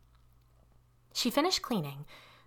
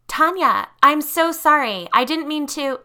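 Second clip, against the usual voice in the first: much higher and louder, desperate apology; frightened pleading; 8 semitones higher, 11 dB louder than usual. Acted. Girl trapped in the limo pleading with Tanya, terrified. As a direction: beg forgiveness in rising fear.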